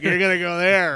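high, loud mock gasp; playful pretend shock; mock disbelief. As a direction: tease her in a shocked voice. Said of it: "You're like".

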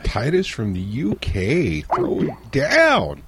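drawn-out announcer call, swooping up at the end; hype announcer. Pretend. Host hails the caller from the UK, drawn-out announcer hype. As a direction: stretch each word like a ring announcer.

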